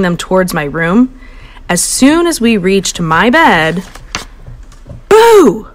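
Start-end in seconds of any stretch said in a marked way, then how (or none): 5.1-5.7 loud high Boo; girl scaring the parents; yell. A narrator's reading. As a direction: jump out and yell. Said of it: "Goldilocks yelled".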